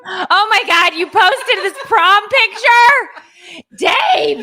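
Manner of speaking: high squealing laughter at the photo; shrieking laugh. Real, not acted. Wife seeing her prom picture posted, squealing laughter. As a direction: shriek in delighted horror.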